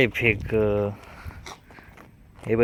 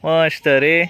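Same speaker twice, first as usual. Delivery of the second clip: higher and louder, rising at the end; excited praise; 4 semitones higher, 6 dB louder, much slower than usual. Real, not acted. Parent praises the child's throw, high, loud and rising.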